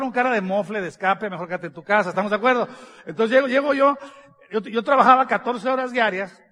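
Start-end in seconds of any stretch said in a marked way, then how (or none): none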